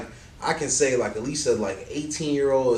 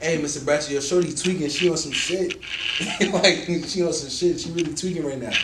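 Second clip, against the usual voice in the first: South Side Chicago voice; 5 semitones higher than usual. Acted.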